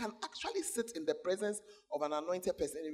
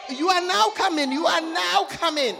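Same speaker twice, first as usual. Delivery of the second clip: loud, high-pitched scolding shout; mother's shouted retort; 9 semitones higher, 13 dB louder than usual. Acted. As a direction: shout down a cheeky youngster. Mother retorts to cheeky girl.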